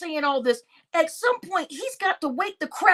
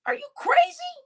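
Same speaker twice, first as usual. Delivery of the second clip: squeaks up high on crazy; incredulous, high pitched; outraged disbelief; 12 semitones higher than usual. Real, not acted. Ranting at Sister T, high incredulous squeak.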